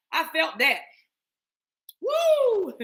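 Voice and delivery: ordinary talk, nothing marked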